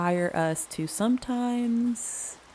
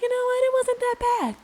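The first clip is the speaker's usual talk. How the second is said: high relieved voice; 8 semitones higher, faster than usual. Retold or imitated. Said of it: "you're like".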